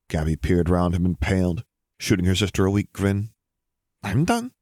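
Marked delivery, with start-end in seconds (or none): 4.0-4.5 bright high-pitched chirp; small, much higher, perky voice. A narrator's reading. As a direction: chirp it, lifting sharply upward. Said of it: "she chirped".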